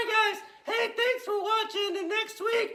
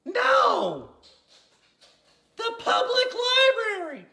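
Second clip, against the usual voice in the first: loud, higher, triumphant reveal; gleeful punchline; 4 semitones higher, 7 dB louder, slower than usual. Acted. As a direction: crow the answer with delight. Gleefully revealing joke answer.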